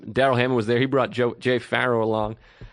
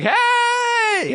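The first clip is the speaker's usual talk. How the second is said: excited shout. Retold or imitated. Woman greeting him at a party with a squeal. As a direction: squeal hello at a friend. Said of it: "She's like".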